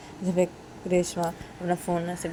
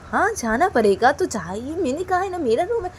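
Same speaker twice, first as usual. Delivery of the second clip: high, loud, exasperated; angry insistence; 12 semitones higher, 9 dB louder than usual. Acted. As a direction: insist he leave her room. Daughter insists father leave. (ऊँची आवाज़ में झुँझलाकर कहना)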